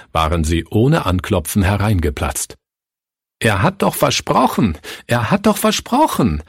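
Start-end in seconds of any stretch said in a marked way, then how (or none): 3.4-4.8 erhöht, flehend gerufen; verzweifelter Ausruf. A narrator's reading. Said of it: "rief Pastor Fritz". 5.1-6.4 viel höher, fast aufschreiend; steigernder Verzweiflungsruf. A narrator's reading. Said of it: "rief Pastor Fritz".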